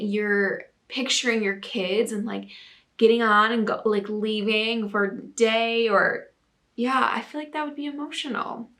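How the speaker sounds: ordinary delivery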